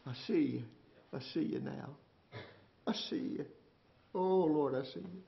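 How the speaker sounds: soft, near-whispered awe; hushed confession. Acted. Job confessing to the Lord, hushed and soft. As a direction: breathe it out in humbled awe.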